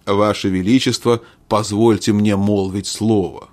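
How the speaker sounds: plain reading voice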